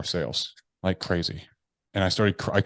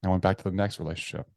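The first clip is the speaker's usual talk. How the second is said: ordinary talk, nothing marked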